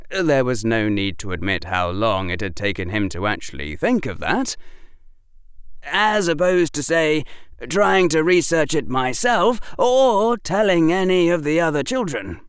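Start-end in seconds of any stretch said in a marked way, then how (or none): 5.8-12.4 put-on higher-pitched boy explaining himself; precocious boy justifying his choice; reasonable schoolboy. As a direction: lift the voice into an earnest pupil's. Narrator voices Harry.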